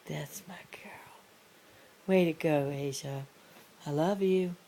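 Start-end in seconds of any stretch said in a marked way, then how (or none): 0.1-1.1 softer whispered praise; whispering. Real, not acted.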